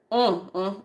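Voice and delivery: ordinary talk, nothing marked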